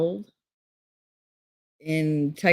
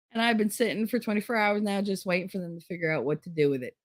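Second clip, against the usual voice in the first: normal talking voice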